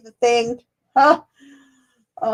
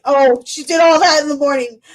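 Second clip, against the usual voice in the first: ordinary talk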